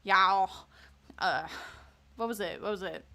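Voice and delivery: loud southern y'all; Texan slip. Retold or imitated. Streamer recalling slipping into a Texan accent. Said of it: "find myself slipping and be like".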